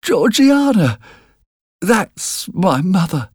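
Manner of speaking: put-on high-pitched, timid child's voice; frightened boy. A narrator's reading. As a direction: explain nervously in a small high voice. Frightened boy points to grave.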